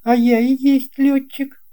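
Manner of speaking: put-on the boy pilot owning it with a small smile; young pilot's reply. A narrator's reading. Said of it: "чуть заметно улыбнулся".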